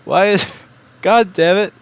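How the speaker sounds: loud, slow, half-laughing outburst; exasperated laughing curse. Real, not acted. Streamer laughing and cursing at broken audio routing, exasperated.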